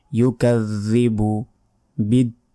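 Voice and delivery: ইউকাযযিবু বিদ্দীন drawn out slowly, the last vowel held long; slow recitation demo. Shown as a demonstration. Teacher shows students the elongation, slowly. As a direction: stretch the final vowel of বিদ্দীন. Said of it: "শেষে তিন second টেনে পড়তে হবে".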